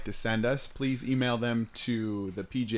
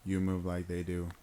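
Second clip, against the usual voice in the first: robot voice; 7 semitones lower, 9 dB quieter than usual. Shown as a demonstration. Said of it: "like, robot, though".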